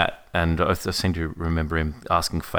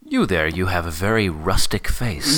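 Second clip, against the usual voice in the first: mock American accent. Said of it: "bad American approximations".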